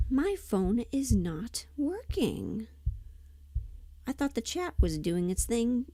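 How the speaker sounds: usual speaking voice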